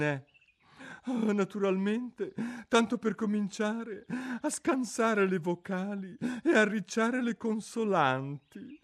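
high, mournful creature voice; a sorrowful old turtle reminiscing; mock turtle voice. Mock turtle telling Alice about school under the sea, mournfully.

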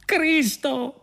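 high-pitched outraged exclamation; exasperated protest. A narrator's reading. Prisoner exasperated by his friend's optimism, high outburst. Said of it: "protestò Arthur".

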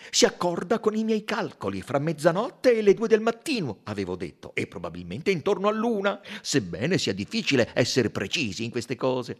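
high, loud, excited explaining; eager doctor. A narrator's reading. Doctor to Poirot about the stopped watch, eager and loud. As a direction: press the point triumphantly.

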